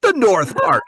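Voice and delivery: loud, high fake Scottish answer; bold Scottish bluff. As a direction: insist in a put-on Scottish accent. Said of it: "do your Scottish accent".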